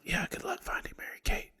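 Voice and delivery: very soft, hushed whisper; whispered send-off. Retold or imitated. Worker sees off the rumor-spreading customer, whispering. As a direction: play along under your breath. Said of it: "Why are we whispering?".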